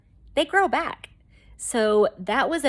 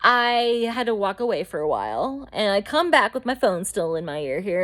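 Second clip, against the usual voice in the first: ordinary talk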